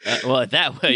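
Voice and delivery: ordinary delivery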